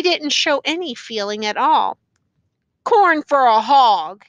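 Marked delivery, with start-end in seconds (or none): 2.8-4.2 loud, slow, level-pitched statement; stubborn demand. A narrator's reading. Prisoner's sullen repeated demand.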